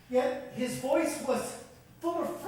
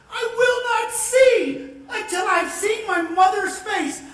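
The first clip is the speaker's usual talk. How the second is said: crying with joy, a little louder; tearful joy; 5 semitones higher, 6 dB louder than usual. Acted. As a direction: voice cracking with happy tears. Son regains sight, sees mother.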